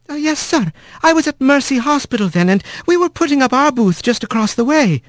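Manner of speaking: high polite nurse wren; eager little bird witness. A narrator's reading.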